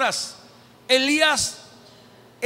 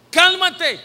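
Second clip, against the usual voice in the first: louder, higher firm "Cálmate"; firm command; 5 semitones higher, 5 dB louder than usual. Acted. Preacher commanding his soul to calm down, firmly. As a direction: order your soul to calm down.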